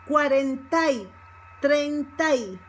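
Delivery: "cuarenta y" ending in a stressed "tai"; Y joined to A. Shown as a demonstration. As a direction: glide "a" into "y" in "treinta y". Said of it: "becomes together with the A".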